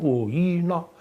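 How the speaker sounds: high, slow put-on Māori accent. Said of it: "Find a Māori voice".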